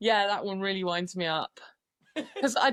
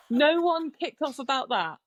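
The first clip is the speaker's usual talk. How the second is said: ordinary talk, nothing marked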